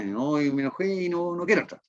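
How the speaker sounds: high, whiny sulking voice; sulky teen. Pretend. Preacher mimics the sulking older son, high and whiny. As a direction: pout it out like a sulking teenager. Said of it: "esta es una amurrada clásica".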